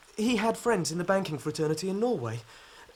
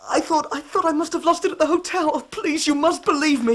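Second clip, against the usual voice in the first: higher and louder, frantic; desperate pleading; 7 semitones higher, 7 dB louder than usual. Acted. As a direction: beg the inspector to believe you. Accused man pleads his innocence.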